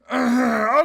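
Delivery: sharp exaggerated throat clear; loud throat clear. Real, not acted. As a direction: clear your throat loudly to cover it. Loud throat clear covering aside.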